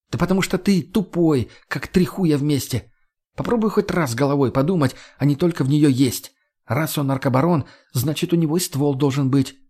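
put-on young woman berating a dimwit; feisty Margo, scolding. Narrator voices Margo.

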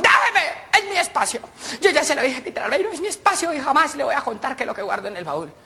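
hammed-up touchy spouse; indignant wife. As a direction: play the wife defending her secret. Wife voiced refusing again.

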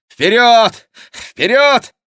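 high, very loud rider's shout; urging command. A narrator's reading. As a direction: shout to spur them onward. Chicot urges riders on. (громкий клич, подгоняющий всадников)

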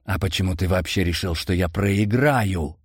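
usual speaking voice

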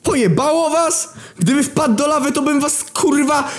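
very high, outraged shriek; furious yelling. Acted. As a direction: scream at reckless brothers. Narrator yells furiously at his brothers.

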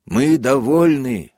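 loud, slow grudging call; sullen shout. A narrator's reading. A prisoner calls out sullenly to the major, loud. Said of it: "угрюмо крикнул вдруг один голос".

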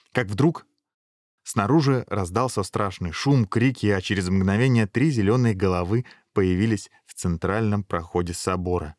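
ordinary delivery, nothing marked

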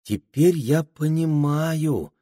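high, slow, dawning understanding; thoughtful. A narrator's reading. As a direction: muse it out slowly. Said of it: "задумчиво проговорила Пенелопа".

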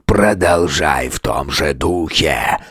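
low, slow, rasping beast; rasping monster voice. A narrator's reading. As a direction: rasp it out like an ancient undead king. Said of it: "прохрипел монстр".

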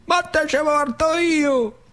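zio Peppe. Acted. Uncle back from the war promising the boy, high slow voice. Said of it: "Me fa zio Peppe".

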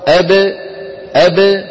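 spring the ب in أب out; أب ending in an echoing ب; qalqala on ba. Shown as a demonstration. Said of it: "أما المقلقل لما منقول أق".

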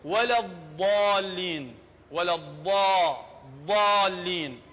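ولا الضالين with a heavy, emphatic ض; الضالين بضاد مفخمة. Shown as a demonstration. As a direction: press the ض in الضالين, dark and heavy. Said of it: "هاي نقول".

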